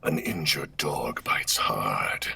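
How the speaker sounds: slow, low dark menace; Omen voice line. Acted. Performing for the chat, slow dark voice. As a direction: growl it out slowly.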